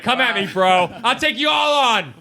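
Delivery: hammed-up bar-fight bravado; belligerent drunk. Mock challenge to the planet.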